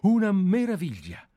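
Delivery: an admiring burst of praise; delighted exclamation. Acted. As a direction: cry out in wonder at the idea. Enthusing over the novel's concept.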